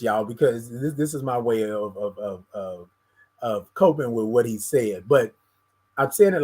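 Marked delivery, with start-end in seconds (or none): none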